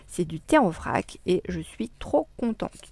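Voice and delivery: ordinary delivery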